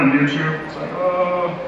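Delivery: ordinary delivery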